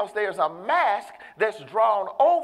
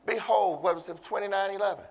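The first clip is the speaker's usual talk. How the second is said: normal talking voice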